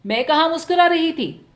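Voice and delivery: a touch of teasing innocence; coy denial. A narrator's reading. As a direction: act innocent with a lilt. Wife denies, feigning innocence.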